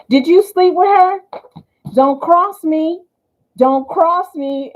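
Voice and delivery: high, loud, mock accusation; accusing. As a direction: confront him, hammed-up. Acted jealous retort.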